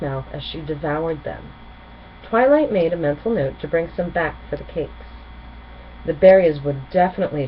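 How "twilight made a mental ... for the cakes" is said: ordinary delivery, nothing marked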